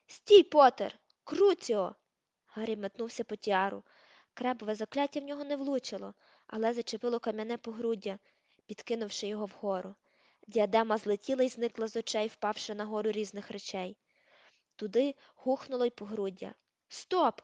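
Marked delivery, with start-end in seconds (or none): 0.1-1.9 loud, higher attack cry; furious shout. A narrator's reading. Crabbe cursing Harry, a furious shout. 16.9-17.4 higher, sharp stop; shout. A narrator's reading. Malfoy halting Crabbe, a sharp shout. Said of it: "крикнув Кребові Мелфой".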